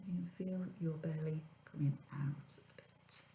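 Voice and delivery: whispered relaxation guide; soft spa whisper. Acted. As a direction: breathe it out softly. Guided breathing exercise.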